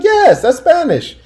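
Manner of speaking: high-pitched, drawn-out imitation of her insisting; mock a woman stubbornly insisting, voice way up; imitated high, slow protest; insistent retort. Acting out her insistent reply.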